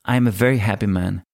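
ordinary talk

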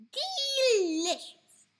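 relishing. Acted. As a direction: savour the word like a treat.

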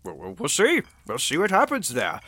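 usual speaking voice

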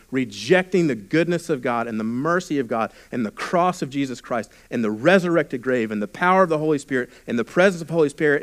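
ordinary talk

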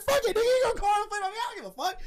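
high, fast retold outburst through laughter; laughing defiant quote. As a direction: fire it off like you don't care, cracking up.

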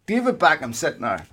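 usual speaking voice

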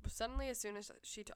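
usual speaking voice

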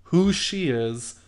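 loud, furious scolding; angry telling-off. Retold or imitated. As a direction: snap at him to back off. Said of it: "one point I flipped out on him".

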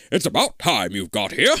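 put-on scientist voice, impatient; eccentric scientist. Tall man greeting the late visitor, impatient. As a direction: snap it in an odd character voice.

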